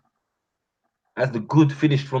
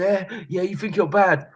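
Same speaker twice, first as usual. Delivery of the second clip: mocking opponent; 10 semitones higher than usual. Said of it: "as he's talking to you".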